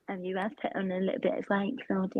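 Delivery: lightly imitated British mum, low and soft; quiet jab in mum's British accent. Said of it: "doing an accent of her mum".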